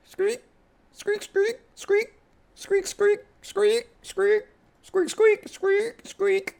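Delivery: high squeaky voice, repeating squeak; imitated sneaker squeaks. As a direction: mimic shoes squeaking on a court. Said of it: "Here's a basketball game on T V".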